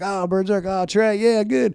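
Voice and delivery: high, bright, eager cheering; excited teammates. Retold or imitated. Teammates greeting the mascot in the clubhouse, high excited cheer.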